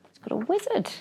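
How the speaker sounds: plain reading voice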